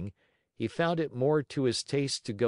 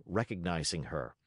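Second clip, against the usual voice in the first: ordinary delivery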